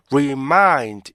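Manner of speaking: "remind" with a long, stretched first syllable; drawn-out REMIND. Shown as a demonstration. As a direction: overlong "reee-mind", as a wrong example. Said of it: "이게 아니고요".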